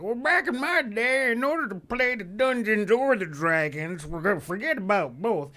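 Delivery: put-on high, loud cranky old-timer rant; old dudes. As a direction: grumble like a grumpy old geezer. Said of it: "I don't wanna sound like one of those old dudes".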